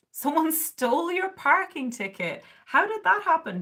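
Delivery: a laughing gasp at the news; surprised. Real, not acted. Reacting to a chat comment.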